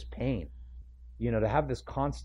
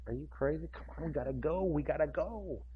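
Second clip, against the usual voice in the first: impatient Jairus; 4 semitones higher, 9 dB quieter than usual. Retold or imitated.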